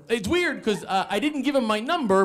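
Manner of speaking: imitated bewildered roommate; puzzled roommate. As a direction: puzzle aloud through the door. Roommate puzzles through door.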